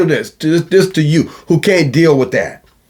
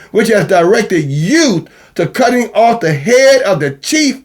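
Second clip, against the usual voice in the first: raised, ringing scripture recital; scripture reading; 4 semitones higher than usual. Acted. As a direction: read it out like holy writ.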